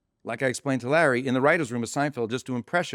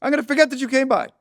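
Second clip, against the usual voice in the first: loud, high-pitched comic rant; mock emphatic dismissal; 10 semitones higher, 5 dB louder than usual. [name] tells the visitor he will forget him, loudly dismissive. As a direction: hammer it home, imitating an irritated star.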